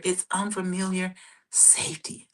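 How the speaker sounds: soft unvoiced whisper; hushed whisper of reassurance. Shown as a demonstration. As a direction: breathe it out like a secret. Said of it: "let me say that again".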